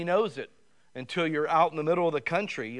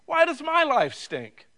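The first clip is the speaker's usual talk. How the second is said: high, whiny plea to God; complaining prayer; 11 semitones higher, 5 dB louder than usual. Retold or imitated. Believer complaining to God about his life, high and whiny. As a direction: whine it up at God like a hurt believer. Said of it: "you say".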